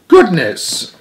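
disgusted. A narrator's reading. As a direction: recoil at the news. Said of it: "in disgust".

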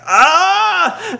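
high, loud straining cry; mock yell of a man under a heavy lift; strained wrestler yell. Mark Henry straining under the lift, loud high yell.

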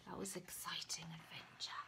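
fast, soft whisper. Real, not acted. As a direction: breathe it out with relief. Whispered aside after climax.

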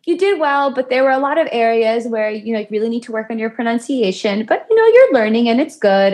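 high-pitched impression of mother; mom's gentle critique. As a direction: imitate a mom softening criticism with encouragement. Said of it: "She goes".

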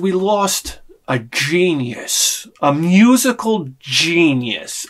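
slow, solemn retold line; slow down and mourn the loss; slow lament. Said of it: "I said".

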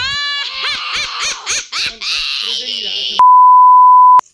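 loud, high, long cackle; screaming laugh. Real, not acted. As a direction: laugh at full volume up into a shriek. Reaction during the game. (carcajada a gritos)

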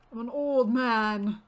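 put-on loud, slow old-man complaint; hammed-up elderly protest. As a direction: drag it out as a lightly voiced old man.

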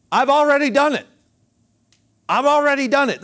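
bold voice of faith, already done; confident declaration. Shown as a demonstration. Preacher showing how the faithful talk, confident declaration. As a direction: declare it like it's finished. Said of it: "That's how they talk".

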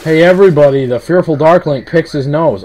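loud, high proclamation; mock announcement. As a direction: call it out to the whole crowd. Mock public announcement to viewers, teasing.

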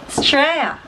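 "Australia" clipped to "Straya"; shortened Aussie pronunciation. Shown as a demonstration. Teacher showing viewers Aussie slang, "Australia" shortened to Straya. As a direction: say "Australia" as STRAY-a, first syllable dropped. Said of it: "Australia is Australia".

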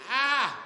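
high, loud mock cry; hammed-up yelp of panic; short high wail; panicked cry. Blinded, groping to the corner.

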